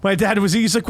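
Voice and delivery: ordinary talk, nothing marked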